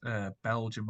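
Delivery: normal talking voice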